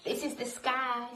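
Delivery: ordinary delivery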